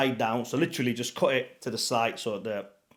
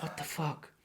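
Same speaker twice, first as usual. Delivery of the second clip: soft disbelieving mutter; half under the breath, stunned; 2 semitones higher, 13 dB quieter than usual. Retold or imitated. Narrator reacts to the brothel worker, soft and stunned.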